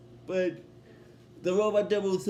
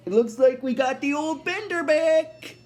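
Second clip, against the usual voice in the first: higher, drawn-up character voice; Fry; 6 semitones higher than usual. Retold or imitated. Friend welcoming the old Bender back, acted higher voice. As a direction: pitch it up as a pleased announcement. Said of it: "Fry's like".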